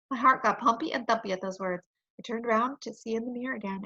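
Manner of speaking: usual speaking voice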